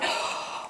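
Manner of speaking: quick startled intake of breath; sharp panicked gasp. Retold or imitated. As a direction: gasp as if your heart is pounding. Heart pounding so hard.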